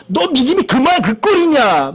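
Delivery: high-pitched imitated rebuke; scolding. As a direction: act out giving someone a telling-off. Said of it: "막 야단치시는 거예요".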